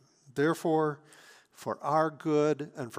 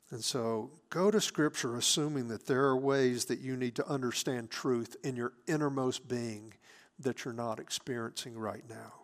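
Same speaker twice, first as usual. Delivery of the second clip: ordinary delivery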